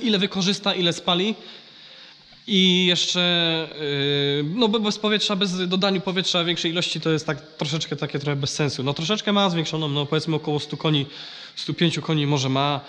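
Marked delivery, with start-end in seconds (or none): none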